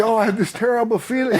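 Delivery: high-pitched comic whine of dread; imitating his own panicked self; mock frightened confession. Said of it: "I'm so frightened".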